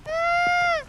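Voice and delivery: a short startled cry; startled yelp. Real, not acted. Streamer startled by something on screen, high yelp.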